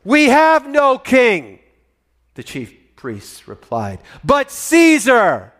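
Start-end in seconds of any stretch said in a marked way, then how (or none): 0.0-1.6 loud, high and very slow, each word dragged; defiant shout. Acted. Chief priests answering Pilate, slow loud defiance. 4.2-5.5 loud, high and very slow, each word dragged; defiant shout. Acted. Chief priests answering Pilate, slow loud defiance.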